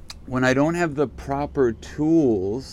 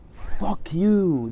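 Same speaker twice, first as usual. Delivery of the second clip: a sharp, higher "Fuck you" aimed at the DJ; mock angry retort; 8 semitones higher than usual. Answering the DJ's message with a mock snap. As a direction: spit it back at him, half joking. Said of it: "That's how I feel right now".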